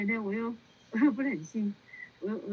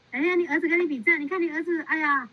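excited praise; 6 semitones higher than usual. Real, not acted. Nun gushing over a child on the call, excited.

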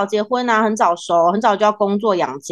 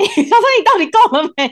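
laughing; 10 semitones higher than usual. Real, not acted. Teasing about cosmetic treatment.

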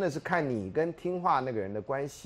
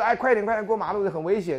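pushy urging; 6 semitones higher, 4 dB louder than usual. Retold or imitated. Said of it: "他觉得".

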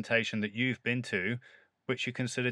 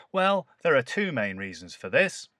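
presenter voice; 3 semitones higher, 5 dB louder than usual. Shown as a demonstration. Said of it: "start off with a rhetorical question".

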